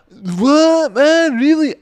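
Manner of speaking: very high, loud squeaky shock; mock disbelief. Mimicking a drunk shocked by his pal's taste. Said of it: "It's like".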